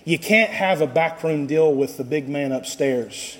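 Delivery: normal talking voice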